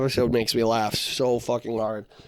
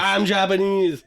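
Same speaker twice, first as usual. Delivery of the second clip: higher, louder, slow mock accent; mock Japanese character; 6 semitones higher, 5 dB louder, slower than usual. Retelling South Park, friend mimics the Japanese guy, mock accent. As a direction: ham up a cartoon Japanese guy. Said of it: "he's always like".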